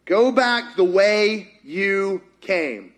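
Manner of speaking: slow, deliberate command; the Lord's command. Acted. The Lord sending Elijah back, slow and firm. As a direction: space out each word with weight. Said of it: "and the Lord said".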